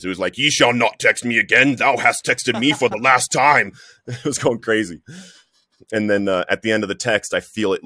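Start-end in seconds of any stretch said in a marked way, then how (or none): 0.4-3.7 loud, higher-pitched mock-biblical decree; King James pronouncement. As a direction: proclaim it like an archaic scriptural rebuke. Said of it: "it was in, like, King James".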